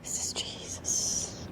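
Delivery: hushed, slow whisper; reverent whisper. Acted. Speaker imitating reverence for Jesus, hushed whisper. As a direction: whisper it slowly, as if in awe.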